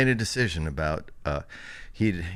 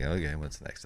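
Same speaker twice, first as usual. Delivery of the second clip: lower, softer lightly acted reply; son going along; 4 semitones lower, 6 dB quieter than usual. Youngest son answering his dad, low and quiet. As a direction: imitate a kid quietly playing along.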